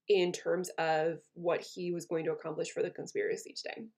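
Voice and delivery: ordinary talk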